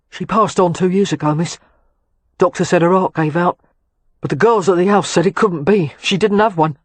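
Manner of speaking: cockney lad glad to see her; South London accent. A narrator's reading. William speaking in his accent.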